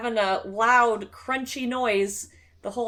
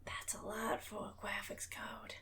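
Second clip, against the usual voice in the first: whisper; 19 dB quieter than usual. Real, not acted. Whispered aside, unvoiced far.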